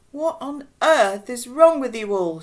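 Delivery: high, loud exasperation; indignant scolding. Acted. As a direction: berate the whole lot of them. Irate woman scolds her rescuers.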